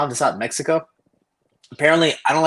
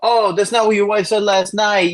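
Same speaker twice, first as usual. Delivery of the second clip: higher, louder, slow teasing jab at the coach; milk the cheap your-wife joke; mocking taunt; 8 semitones higher, 6 dB louder than usual. Teen teasing his wrestling coach about his wife, high mocking jab. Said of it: "something stupid".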